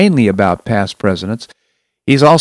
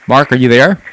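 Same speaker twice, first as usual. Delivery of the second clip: shouting into a phone; 8 dB louder than usual. Real, not acted. Host calls out to silent caller.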